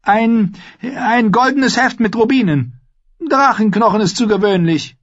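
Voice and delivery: high-pitched royal order; high, imperious demand; haughty boy king. A narrator's reading. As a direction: give orders fussily in a high voice.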